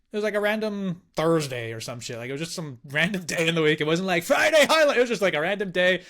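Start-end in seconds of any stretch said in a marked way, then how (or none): none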